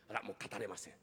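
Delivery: low, soft sulking mutter; a sulking master, too proud to sing; sulky refusal. Acted. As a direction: pout and refuse to perform.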